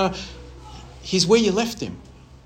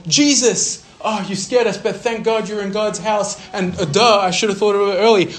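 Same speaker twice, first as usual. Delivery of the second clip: higher, louder, fluttery relieved mom; flustered relieved mother; 7 semitones higher, 5 dB louder than usual. Pretend. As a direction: play a mom half scolding, half relieved.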